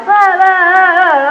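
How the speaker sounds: high, loud wordless rant, re-enacted; mock yelling. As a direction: yell it out like a torrent of cursing. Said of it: "I'm yelling".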